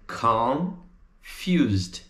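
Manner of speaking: "Con... fused", slow with a long gap; syllable by syllable. Shown as a demonstration. Teacher breaks down the word, syllable by syllable. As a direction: split Confused in two.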